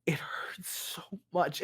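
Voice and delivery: whispered, breaking into a sob; crying whisper. Acted. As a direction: barely get it out through tears. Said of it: "And she's crying more".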